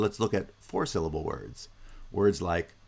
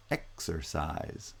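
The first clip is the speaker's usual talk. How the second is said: exercise in careful pieces, EX-er-CISE; weight EX and CISE, reduce the middle of exercise; first and third stressed; 3 semitones lower, 8 dB quieter, slower than usual. Shown as a demonstration. Teacher showing middle-syllable reduction, exercise with first and third stressed. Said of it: "both the first and the third syllables are stressed".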